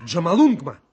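loud, excited guess; an eager child's guess, shouted out loud. Acted. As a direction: blurt out the name with excitement.

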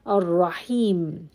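Ar-Rahim ending cleanly on a closed m; stop on mim. Shown as a demonstration. Teacher modelling the final stop on mim. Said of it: "I must show that I stop in mim".

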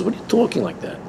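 high-pitched, disbelieving retort; incredulous rebuke. Retold or imitated. Rebbe answers a chassid who says it's impossible, incredulous. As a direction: imitate a Rebbe challenging a doubter in disbelief.

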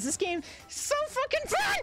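high-pitched sarcastic squeal; mock delight, pitch way up. Real, not acted. Sarcastic after losses.

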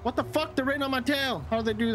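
can't believe they caught up; startled high-pitched disbelief; shocked disbelief. Real, not acted. Cops suddenly on his tail.

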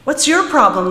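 put-on loud, high angry girl; little girl shrieking at Larry after they collide. A narrator's reading. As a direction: snap at him after the crash. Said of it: "the little girl shrieked at Larry".

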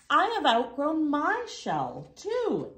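a kindly, lifted answer; eager reply. A narrator's reading. Hermit Crab answers, raised voice.

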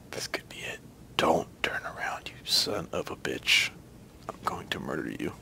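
menacing whisper. Real, not acted. Creeping up behind enemy.

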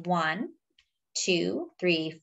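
ordinary talk

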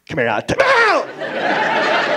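high, slow, sly dad voice; dad luring the kid in to scare him, high and slow. Retold or imitated. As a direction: coax the kid in, slowly.

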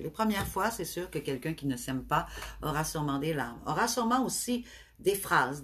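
usual speaking voice

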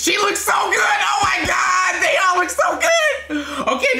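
high excited shout with laughter; excited squeal. Real, not acted. Fan squealing at the cast on screen.

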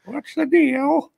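very high, drawn-out parrot voice. Acted. Host voicing a parrot in goal, high comic voice. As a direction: squawk it slowly as a chilled-out parrot. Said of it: "The real parrot would just".

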